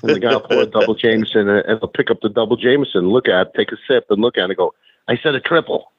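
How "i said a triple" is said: lightly imitated Boston accent. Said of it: "in that Boston accent".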